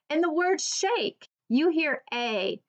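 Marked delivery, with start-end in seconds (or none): none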